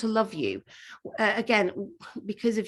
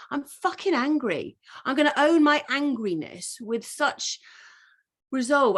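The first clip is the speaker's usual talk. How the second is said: higher, emphatic declaration of anger; owning anger; 7 semitones higher, 3 dB louder than usual. Retold or imitated. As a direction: say it like you finally own your anger. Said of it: "to say".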